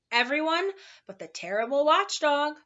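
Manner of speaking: plain reading voice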